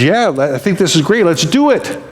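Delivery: retold excitement, pitch lifting at the end; excited agreement. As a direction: voice a wife keen to jump in. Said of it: "he's really excited".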